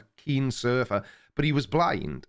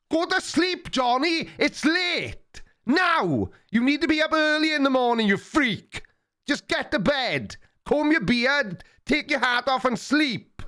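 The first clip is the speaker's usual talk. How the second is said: mock ASMR shouting; 12 semitones higher than usual. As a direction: bark him off to bed, shrill. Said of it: "That's Creaky Blinder A S M R".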